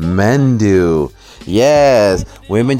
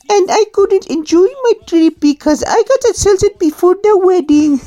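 high, put-on girlish voice with an accent; Indian little girl voice. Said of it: "little girl voice".

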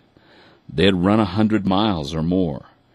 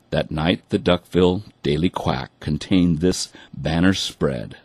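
usual speaking voice

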